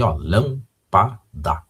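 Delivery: lâmpada said slowly, syllable by syllable. Shown as a demonstration. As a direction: stretch out lâmpada so each syllable is heard. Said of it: "Já está silabada a palavra aí". (lâmpada dita devagar, sílaba por sílaba)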